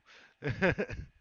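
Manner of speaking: short, high laugh. Real, not acted. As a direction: laugh at your own joke. Laughs after German joke.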